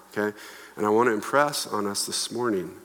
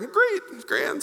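much higher, sing-song fake brightness; fake cheerful; 14 semitones higher than usual. Churchgoer hiding hurt, answering a greeting with forced, much higher cheer. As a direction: chirp it like nothing's wrong. Pretending all is fine.

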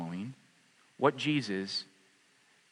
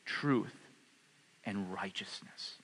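whispered prayer; 4 semitones lower, 10 dB quieter, slower than usual. Real, not acted. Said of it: "praying, trails to whisper".

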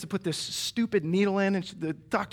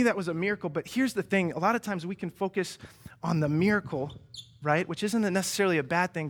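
ordinary talk

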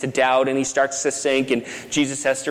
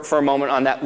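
ordinary delivery

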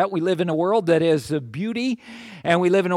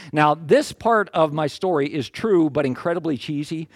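usual speaking voice

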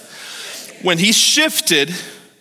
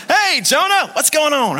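bright, much higher friendly host hail; cheery greeting; 8 semitones higher, slower than usual. Pretend. Cruise host greeting Jonah in a bright high voice.

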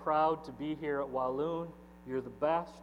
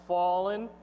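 raised, ringing proclamation; mighty shout; 4 semitones higher, 5 dB louder, slower than usual. Acted. Pastor reads the angel's announcement of Babylon's fall, mighty shout. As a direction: call each word out higher and louder. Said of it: "with a mighty voice shouts".

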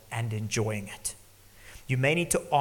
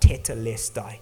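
crying out; 7 dB louder than usual. Retold or imitated. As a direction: call out the Greek word Tetelestai loud. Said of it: "Jesus cried out".

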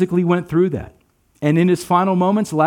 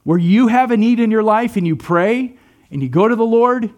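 ordinary talk, nothing marked

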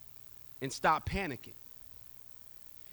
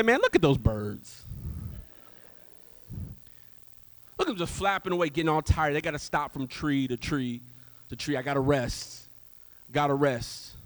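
lower eagle voice; 5 semitones lower than usual. Acted. Eagle talking to another eagle, lower and mocking. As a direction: drop the voice and look down on the flappers.

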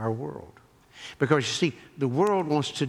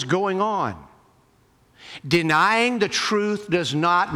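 higher, insistent pulpit voice; impassioned preaching; 7 semitones higher, 6 dB louder than usual. Real, not acted. Preacher urging listeners to stand boldly on truth, impassioned preaching. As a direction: drive each phrase home with rising fervor.

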